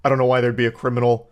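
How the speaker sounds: usual speaking voice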